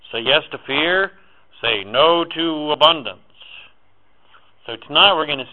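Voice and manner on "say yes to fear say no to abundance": voice of the programming. Retold or imitated. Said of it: "the programming has just said".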